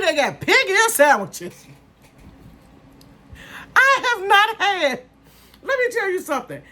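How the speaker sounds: gushing about pig ear sandwiches in amazement; amazed. Real, not acted. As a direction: squeal it like you can't believe it.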